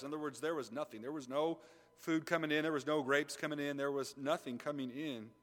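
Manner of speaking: usual speaking voice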